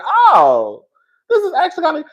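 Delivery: high-pitched, pleased "oh" of discovery; pleasantly surprised. Retold or imitated. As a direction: react as if won over unexpectedly. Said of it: "I was pleasantly surprised".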